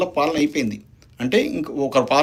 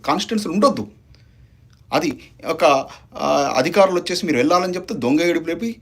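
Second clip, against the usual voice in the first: usual speaking voice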